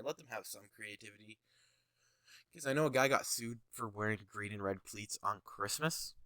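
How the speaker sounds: ordinary talk, nothing marked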